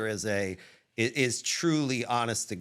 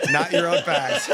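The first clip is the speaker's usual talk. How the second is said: louder, laughing through the words; 7 semitones higher, 7 dB louder than usual. Real, not acted. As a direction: laugh as you land the point. Laughing while arguing [name].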